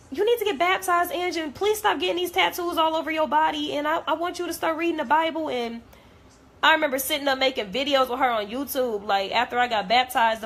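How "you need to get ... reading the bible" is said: high-pitched imitation of a devout friend; Queen. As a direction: plead with a friend in a higher voice. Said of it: "she was just humble and all about God".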